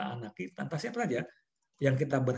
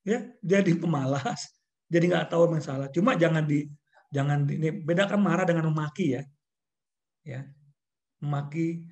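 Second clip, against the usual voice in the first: ordinary talk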